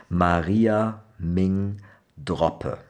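"Maria Ming Droppe" said slowly in Rhineland dialect. Shown as a demonstration. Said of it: "if you say it like that, you actually speak, uh, Lower German".

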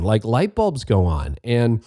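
usual speaking voice

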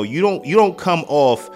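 ordinary delivery

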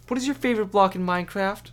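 high, loud, quick parody line; hammed-up poor delivery; mock bad acting. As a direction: rattle off a silly question in a put-on high voice.

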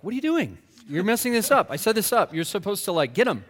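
half-hammed annoyed friend; mock exasperated friend.

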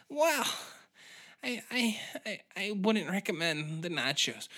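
hesitant, stammering waitress; a hint of shy Sally; nervous waitress.

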